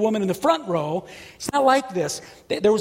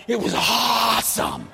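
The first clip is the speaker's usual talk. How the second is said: ordinary talk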